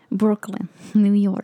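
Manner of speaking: badly faked, hammed-up New York accent. Shown as a demonstration. Showing a friend, put-on New York accent. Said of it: "that in New York accent".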